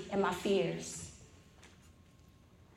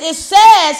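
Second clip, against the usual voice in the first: louder, higher, exasperated reading of "Alcoholics Anonymous"; fed-up retort; 8 semitones higher, 10 dB louder than usual. Retold or imitated. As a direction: snap it back like she's losing patience. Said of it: "Now I'm really mad now".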